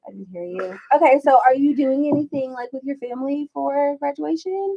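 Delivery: ordinary talk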